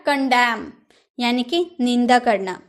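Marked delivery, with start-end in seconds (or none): 0.1-0.7 कंडेम said with no final n; teacher models कंडेम, n silent; correct pronunciation. Shown as a demonstration. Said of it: "कंडेमन नहीं बोलेंगे ना".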